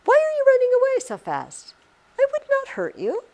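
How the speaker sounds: very high voice pleading with a tiny mouse; high, acted reassurance; high-pitched coaxing. Voices the poem's speaker addressing mouse.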